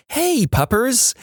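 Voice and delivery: high, slow, cooing pet voice; dog-talk voice. Retold or imitated. Hiker greeting a pack of dogs, dog-talk voice. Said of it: "same voice I use for my dog".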